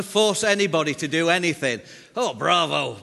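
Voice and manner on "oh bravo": laughing upper-class English cheer; loud posh English bravo; posh English accent. Shown as a demonstration. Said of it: "Stiff upper lip and all that".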